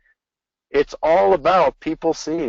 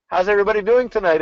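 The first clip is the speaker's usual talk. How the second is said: quick, higher-pitched rallying greeting; high energy; 7 semitones higher, faster than usual. Shown as a demonstration. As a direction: call out to pump up the crowd. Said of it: "if I give you energy".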